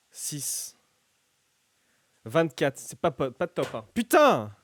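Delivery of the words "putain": loud, high swear; frustrated curse. Real, not acted. Bad dice roll.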